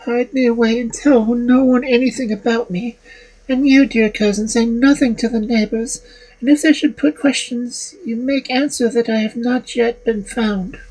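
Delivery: put-on high, reproachful girl's voice; indignant young woman.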